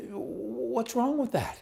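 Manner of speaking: very high, slow disbelief; incredulous. Real, not acted. Asking critics of the question, slow high disbelief. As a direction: stretch out the disbelief.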